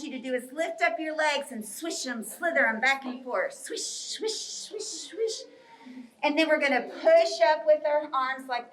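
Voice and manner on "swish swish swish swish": soft, hushed swishes; whispered swishing. Pretend. Teacher leading snake legs back and forth, whispered swishing. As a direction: whisper it with long sh sounds.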